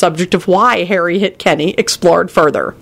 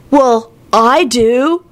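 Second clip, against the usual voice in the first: slow, higher-pitched fake hurt; falsely pained; 6 semitones higher, much slower than usual. A narrator's reading. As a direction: draw it out with phony sympathy. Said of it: "said in a falsely pained voice".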